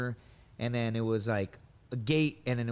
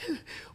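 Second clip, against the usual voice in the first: sobbing gasp; 6 dB quieter than usual. Retold or imitated. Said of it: "he's like".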